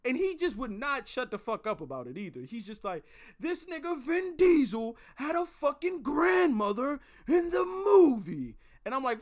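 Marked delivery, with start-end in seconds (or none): 3.4-8.6 yelling like an enraged gumball machine; high, loud outraged holler, stretched at the end; Benson. Retold or imitated. Livid friend screaming into the caller's ear, cartoonish yelling.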